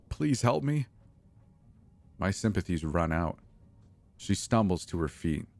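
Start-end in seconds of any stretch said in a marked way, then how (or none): 0.1-0.9 high pleading voice, please stressed; begging. A narrator's reading. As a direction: beg for help, voice pitched up. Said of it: "she begs me".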